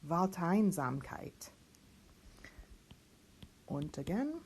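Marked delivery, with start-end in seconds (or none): 0.1-1.5 Wald, Einsamkeit slowly with a clear V sound; teacher sounds out a long compound word. Shown as a demonstration. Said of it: "let's sound this guy out".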